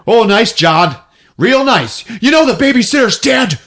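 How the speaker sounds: guilt-trip him sarcastically; high mock reproach; sarcastic. Character scolding sarcastically.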